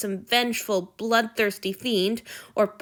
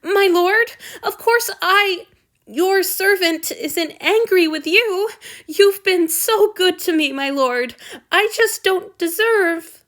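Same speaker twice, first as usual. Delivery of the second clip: high, loud, slow tearful protest; miserable tearful; 11 semitones higher, 8 dB louder than usual. A narrator's reading. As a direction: plead through near tears. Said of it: "miserable sniffle".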